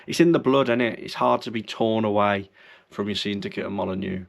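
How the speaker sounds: usual speaking voice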